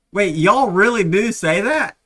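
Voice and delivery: loud, high-pitched disbelief; incredulous question, replayed big; astonished. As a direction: retell your amazement, voice jumping up loudly. Retells amazement at hearing "eh.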